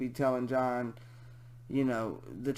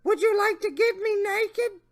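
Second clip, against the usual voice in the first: high, louder creepy question; high mock leer; Jeff Stacy voice; 7 dB louder than usual. Reading the predator's question, high mocking voice. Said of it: "my Jeff Stacy voice".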